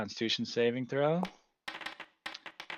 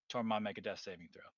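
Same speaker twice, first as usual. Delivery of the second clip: usual speaking voice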